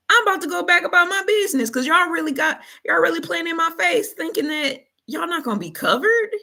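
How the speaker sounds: brushing off the fearful crew; scolding Jesus. Retold or imitated. Jesus scolding disciples, laughing.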